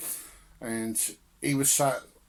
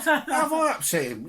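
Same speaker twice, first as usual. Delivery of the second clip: high, laughing protest; laughing disbelief; 12 semitones higher, 4 dB louder than usual. Retold or imitated. Defending himself to his brother, laughing and much higher. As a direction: laugh through a mock protest. Retold indignant reply, laughing.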